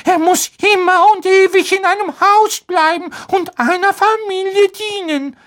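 very high squeaky elf voice, hesitant; a timid little elf struggling for words; nervous house-elf. A narrator's reading.

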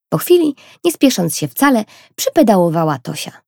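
plain reading voice

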